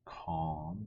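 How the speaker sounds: ordinary delivery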